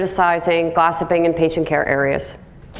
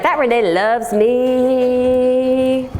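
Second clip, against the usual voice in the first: higher, very slow dreamy self-satisfied voice; naive Debbie; 6 semitones higher, much slower than usual. Retold or imitated. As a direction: savor it slowly, sure she's loved. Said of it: "Debbie's like".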